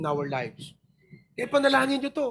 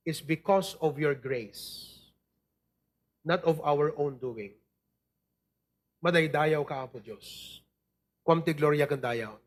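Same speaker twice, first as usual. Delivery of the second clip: a pastor's earnest closing prayer. Acted. Closing prayer after sermon.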